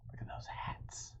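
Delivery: hushed whisper pointing at the picture; whispered aside. Acted. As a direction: lean in and whisper to the kids. Aside to children about picture.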